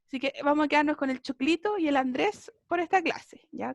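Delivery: ordinary delivery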